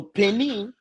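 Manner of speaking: "penny" with French vowels, pay-NEE; French pronunciation. Shown as a demonstration. Explaining the French word, "penny" pronounced as pay-NEE. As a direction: say "penny" high, the French way, ending in long ee. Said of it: "In French".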